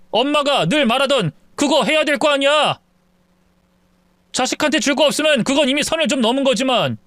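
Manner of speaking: plain reading voice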